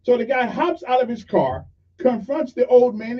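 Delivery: usual speaking voice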